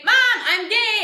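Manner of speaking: acted son blurting it out at full volume; exasperated announcement. Son coming out to his mother, loud and exasperated.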